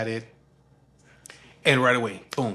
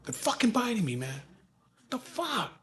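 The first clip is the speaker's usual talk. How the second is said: high-pitched mimicked complaint, held-back volume; losing it; 9 semitones higher, 4 dB quieter than usual. Father complaining of fly bites, losing it. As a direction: imitate an aggrieved gripe. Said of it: "he loses shit".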